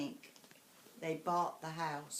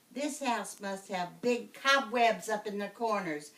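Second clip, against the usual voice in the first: defiant witch; 4 semitones higher, 6 dB louder than usual. Acted. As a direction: chant her list like a witch.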